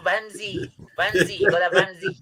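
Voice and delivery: high-pitched put-on Welsh; Welsh accent. Showing the mates he can do Wales, Welsh accent. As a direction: sing out a playful Welsh impression. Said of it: "I could do Wales".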